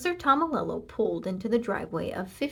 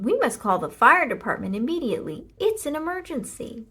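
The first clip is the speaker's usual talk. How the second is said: urgent Mister Watson; 5 semitones higher, 6 dB louder than usual. A narrator's reading. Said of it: "said Mister Watson".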